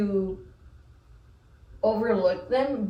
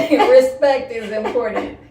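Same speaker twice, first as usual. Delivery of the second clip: loud words through real laughter; laughing agreement; 3 semitones higher, 10 dB louder than usual. Real, not acted. Co-host sets the slip straight, laughing. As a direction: crack up while setting it straight.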